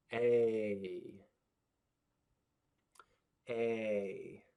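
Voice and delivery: long A said like the letter name; long ay as in bake. Shown as a demonstration. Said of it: "just like the letter name".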